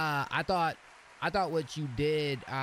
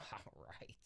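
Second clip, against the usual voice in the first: breathy laugh; 6 semitones lower, 17 dB quieter than usual. Real, not acted. Breathless laugh after excitement.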